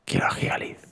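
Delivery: hushed, unvoiced wish; longing whisper. Real, not acted. Player wanting Gigalith, longing whisper. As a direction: whisper it with yearning.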